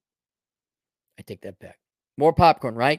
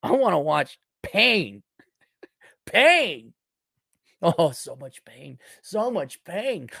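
savoring relish; 4 semitones higher, slower than usual. Pretend. Relishing the thought of pain.

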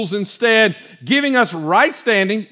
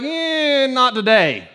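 imitated reluctant congregant; 3 semitones higher, much slower than usual. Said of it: "half of you are like".

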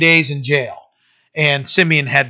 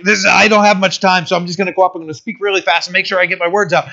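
rushed, higher, tumbling words out fast; panicked fast talk; 3 semitones higher, faster than usual. Retold or imitated. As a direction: blurt it all before time runs out. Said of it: "I wonder if they were speaking fast".

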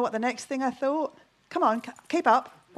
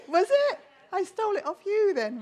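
high-pitched mock surprise; squeaky, playful comeback; 9 semitones higher than usual. Real, not acted. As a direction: jump up high, teasingly taken aback. Teasing reply to audience member.

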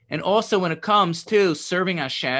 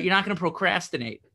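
ordinary delivery

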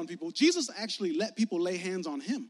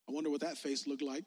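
ordinary talk, nothing marked

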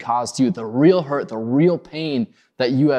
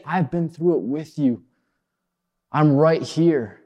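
gentle reassurance; 4 semitones higher than usual. Retold or imitated. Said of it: "No, he's saying".

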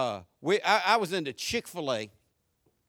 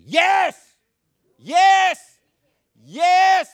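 higher, building preacher's challenge; full-force preaching; 12 semitones higher, 11 dB louder, much slower than usual. Real, not acted. Preacher challenging the congregation to sing, full-force preaching. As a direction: build to a ringing, drawn-out question.

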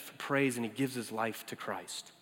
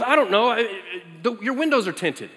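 flustered Jeff; 6 semitones higher, 8 dB louder than usual. Retold or imitated. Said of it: "And he goes".

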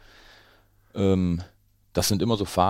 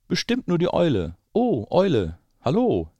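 much higher, louder bright mouse voice; cheeky mouse greeting; 11 semitones higher, 7 dB louder than usual. Acted. Mouse cheekily greeting the owl, high mouse voice. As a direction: pipe up as the little mouse.

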